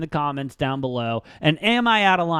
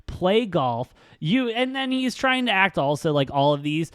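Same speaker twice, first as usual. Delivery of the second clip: ordinary talk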